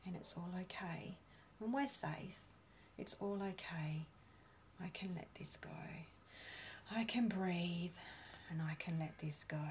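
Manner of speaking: hushed calming self-talk; calm whisper. Shown as a demonstration. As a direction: let the release come out as a whisper. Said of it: "a bit of a release".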